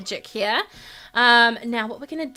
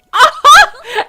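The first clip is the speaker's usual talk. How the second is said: loud, high squeal of laughter; delighted laugh; 16 dB louder than usual. Real, not acted. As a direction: burst out laughing with joy. Her character said yes.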